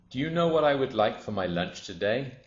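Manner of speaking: lightly voiced crocodile with lunch on its mind; a hungry crocodile, animated; eager crocodile.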